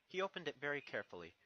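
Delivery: usual speaking voice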